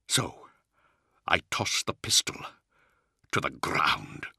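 hushed, halting confession close to tears; tearful shame. A narrator's reading. Luther weeping as he admits sparing the child, hushed and ashamed. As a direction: let it drop toward a whisper, broken by pauses. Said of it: "Tears were flowing freely".

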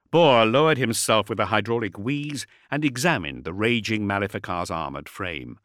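ordinary delivery, nothing marked

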